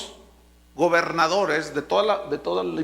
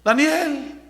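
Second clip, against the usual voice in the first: high, slow, louder anguished call; lamenting; 8 semitones higher, 6 dB louder, slower than usual. Acted. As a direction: cry out in fearful hope. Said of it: "lamentándose". (el rey clama lamentándose ante el foso)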